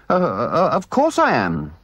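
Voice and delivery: high, hesitant, stammering assent; stammer, then agree eagerly; loyal. A narrator's reading. Mole backing his friend Rat, hesitantly loyal. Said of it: "said the Mole loyally".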